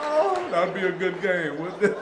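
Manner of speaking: laughing. Real, not acted. Reacting to the joke on the clip, laughing hard. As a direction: talk while cracking up.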